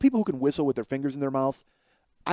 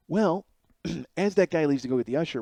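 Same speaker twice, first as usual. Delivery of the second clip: ordinary talk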